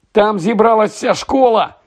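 high-pitched excited call to teammates; excited exclamation. A narrator's reading. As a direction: call out the big news, pitched well up. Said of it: "вигукнув Фред Візлі".

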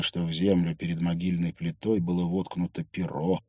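ordinary delivery, nothing marked

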